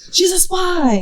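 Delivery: high, loud mock alarm; mock accusation. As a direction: blurt it out like exposing an impostor. Mock accusers catching her accent.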